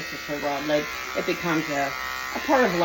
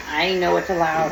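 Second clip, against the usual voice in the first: usual speaking voice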